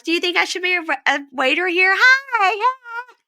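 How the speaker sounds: put-on high girlish baby voice; squeaky little-girl impression. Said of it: "And she's like".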